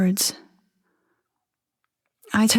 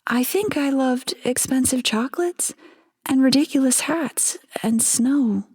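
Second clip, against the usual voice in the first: put-on lightly raised, wistful young wife; wife's voice; 6 semitones higher than usual. Narrator reading the captive wife's memories, lightly raised voice. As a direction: voice her dreamily, a bit higher.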